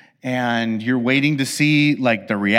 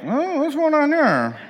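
puzzled; 10 semitones higher than usual. Retold or imitated. Confused quote of gift receiver.

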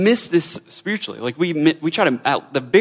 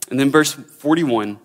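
ordinary talk

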